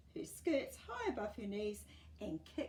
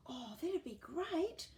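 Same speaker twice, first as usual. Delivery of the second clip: put-on slow, higher delighted boy; boy, eager; 8 semitones higher, slower than usual. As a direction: savour the offer of cake. Boy accepts cake.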